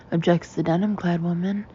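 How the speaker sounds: ordinary delivery, nothing marked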